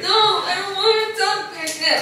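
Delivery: giggling while pretending to cry; trying to hold a laugh in; laughing through fake crying. Real, not acted. Fake crier cracking up, laughing.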